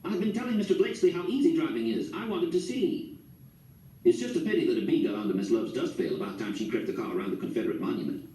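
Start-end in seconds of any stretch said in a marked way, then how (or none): 0.0-3.2 higher, eager and thrilled; bubbling with excitement to drive; eager lady, excited, slowing at the end. A narrator's reading. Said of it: "She was real excited".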